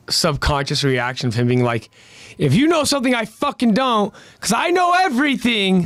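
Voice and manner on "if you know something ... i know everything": high-pitched exasperated rant; frustrated. Acted. Eren venting at Hanji's lack of understanding, frustrated. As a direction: push it high, fed up with not being understood. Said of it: "he's frustrated at her lack of understanding".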